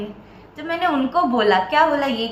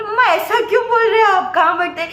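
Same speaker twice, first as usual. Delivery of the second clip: retold hurt outburst, higher and louder; hurt protest; 8 semitones higher, 5 dB louder than usual. Daughter protesting to angry mother, hurt and raised. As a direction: cry out in wounded protest, pitched up. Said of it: "मेरे को बुरा लग गया".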